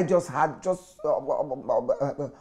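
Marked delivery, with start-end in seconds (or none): none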